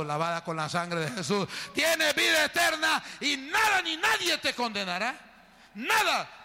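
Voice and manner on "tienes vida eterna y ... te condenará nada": high, loud shouted preaching; preaching at full force. Acted. Pastor proclaims forgiveness to the congregation, high shouted preaching. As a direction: proclaim it over the whole church.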